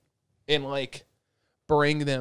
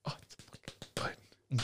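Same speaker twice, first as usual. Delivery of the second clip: slow, hushed whisper; awed whisper; 9 dB quieter, slower than usual. Retold or imitated. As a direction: whisper it slowly, as if overwhelmed. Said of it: "I'm like".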